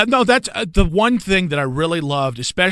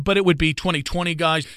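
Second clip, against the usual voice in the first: ordinary delivery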